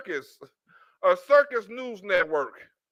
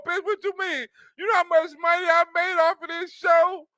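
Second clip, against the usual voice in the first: fake-sob over losing the show, pitched higher; mock crying; 6 semitones higher than usual. Mocking the host crying over her paycheck, higher whiny sob. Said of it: "she's crying because she's losing the paycheck".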